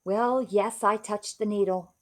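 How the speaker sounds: usual speaking voice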